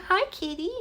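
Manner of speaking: high cooing pet voice; baby talk. Pretend. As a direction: squeak out a tiny greeting for the cat. Voicing greeting for cat.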